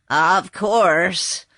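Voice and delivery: gruff horse growl. Acted. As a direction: growl it out, slow and gloomy. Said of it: "growled the horse".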